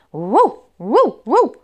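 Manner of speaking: high, loud puppy bark. Acted. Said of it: "zaszczekał piesek".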